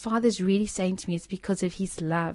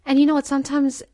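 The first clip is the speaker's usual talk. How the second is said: higher and louder burst of fervour; excited; 7 semitones higher, 8 dB louder, faster than usual. Real, not acted. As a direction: lift it up as the big point. Preacher's excited turn to point.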